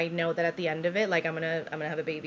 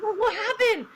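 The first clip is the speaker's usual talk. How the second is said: high, louder mock-startled question; retold alarm; 5 dB louder, slower than usual. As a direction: burst in with acted alarm. Doctor returns in alarm.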